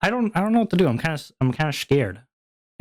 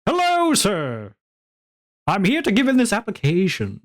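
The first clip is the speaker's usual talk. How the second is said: a hammed-up polite applicant voice; overly polite applicant; 8 semitones higher than usual. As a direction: play the stiff eager job seeker. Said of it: "you're like".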